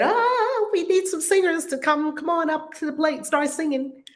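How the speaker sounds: high, sing-out call to singers; voice of Spirit. Acted. Host voicing Spirit calling singers up, high playful call. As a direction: open on a high drawn "ah", then summon them. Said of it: "Spirit said".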